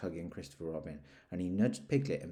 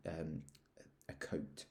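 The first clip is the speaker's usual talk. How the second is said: ordinary delivery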